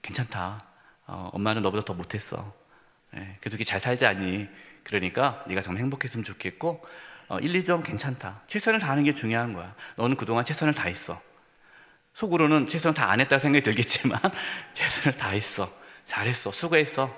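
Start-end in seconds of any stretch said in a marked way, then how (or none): 0.0-11.2 put-on soft, gentle reassurance to a child; comforting mother. Mother consoling child after poor test, soft and tender. 14.7-17.1 soft, tender encouragement; gentle praise. Acted. Comforting mother praising child.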